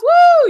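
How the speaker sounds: loud, very high woo; high-pitched celebratory whoop; cheering whoop. Retold or imitated. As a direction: whoop like an overexcited fan. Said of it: "And Nog starts cheering".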